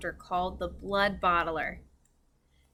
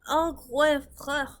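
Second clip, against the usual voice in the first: 1 growling giant; 6 semitones higher, 5 dB louder, slower than usual. A narrator's reading.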